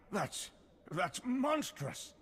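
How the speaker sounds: high, slow, stunned outrage; shocked outrage. Acted. Companion reacting to the Hutts' plan, aghast and outraged. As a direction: recoil at the monstrous betrayal. Hearing the Hutts will abandon everyone.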